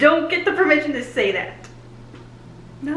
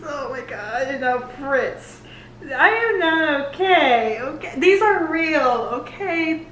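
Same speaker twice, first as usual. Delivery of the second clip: ordinary talk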